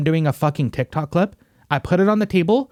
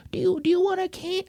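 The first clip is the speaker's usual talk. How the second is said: high cooing voice, much higher and softer; baby talk; 5 dB quieter than usual. Retold or imitated. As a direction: coo it at a baby. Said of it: "like, do the whole".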